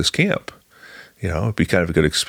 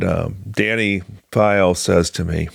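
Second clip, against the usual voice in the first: ordinary delivery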